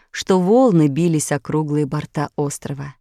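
plain reading voice

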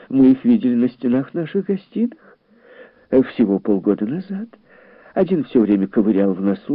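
high, animated lady voice; gossipy indignation, high and lively; indignant exclamation. A narrator's reading. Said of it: "воскликнула миссис Фелпс".

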